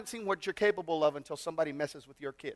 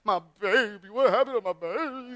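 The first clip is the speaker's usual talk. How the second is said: hammed-up cooing worry, making a fool of yourself; doting dad fussing; 3 semitones higher, 4 dB louder than usual. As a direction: imitate yourself fussing over your little one. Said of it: "I'm talking to her like that".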